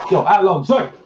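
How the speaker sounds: high, loud calling-out; playful yell. Pretend. Joining the pretend sub-shop scenario, high shouted call. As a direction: call out to someone across the restaurant. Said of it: "You have to yell Alonzi over".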